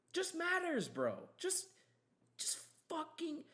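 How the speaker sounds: high, pleading exasperation; exasperated. Real, not acted. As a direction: throw up your hands in disbelief. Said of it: "exasperated rant".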